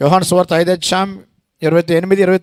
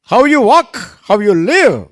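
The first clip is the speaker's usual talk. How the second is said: high, loud English plea; ringing exhortation; 6 semitones higher, 5 dB louder, much slower than usual. Real, not acted. Preacher urging Christians in English, high and loud. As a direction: lift the challenge up to the crowd.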